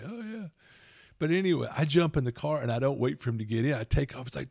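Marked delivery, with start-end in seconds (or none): 0.0-0.5 high and much softer, smug; smug kid reply. Retold or imitated. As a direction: answer as the smug son. Son's smug reply mimicked.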